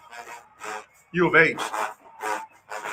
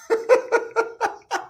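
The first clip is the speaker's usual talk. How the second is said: high squeal laugh; 4 dB quieter than usual. Real, not acted. Laughs at own stop.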